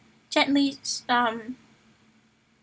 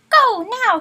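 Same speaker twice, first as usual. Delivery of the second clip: yell; 9 semitones higher, 7 dB louder than usual. Acted. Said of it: "he yelled".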